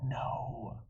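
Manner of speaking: usual speaking voice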